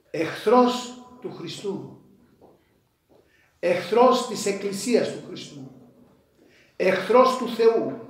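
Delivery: building emphasis. Real, not acted. Preacher builds repeated accusation.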